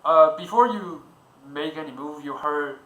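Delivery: usual speaking voice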